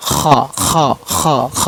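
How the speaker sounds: too much friction on খ, as a warning demo; heavy, noisy খ repeated in separate bursts; overdone throat rasp. Shown as a demonstration. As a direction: exaggerate the scrape at the back of the throat in খ. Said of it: "এত না আবার".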